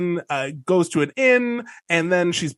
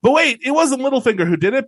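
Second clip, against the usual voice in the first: ordinary talk, nothing marked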